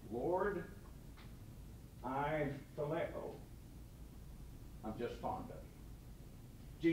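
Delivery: slow, soft, trailing admission; sheepish whisper. Acted. Peter admitting to Jesus he is only fond, whispered. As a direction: admit it quietly, almost ashamed.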